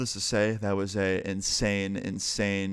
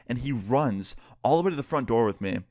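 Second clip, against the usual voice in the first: ordinary delivery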